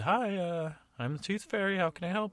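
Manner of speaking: high, light fairy voice; Tooth Fairy voice. Acted. Tooth Fairy greeting the gnome at her door, high voiced. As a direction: raise the pitch high and sweet for the fairy. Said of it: "She said".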